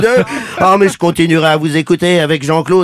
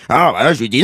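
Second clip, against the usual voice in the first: ordinary delivery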